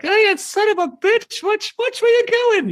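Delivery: very high, angry New York puppet; Rage Rabbit. Acted. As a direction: yell at a bad driver in high falsetto. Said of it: "Rage Rabbit would, of course, just be like".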